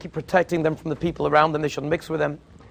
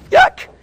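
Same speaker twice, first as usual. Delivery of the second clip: a high loud yelped yuck; disgusted yuck; 10 dB louder than usual. Real, not acted. Preacher shows the crowd how idols should disgust them. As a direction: squeal the yuck in revulsion. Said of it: "spoken disgust sound".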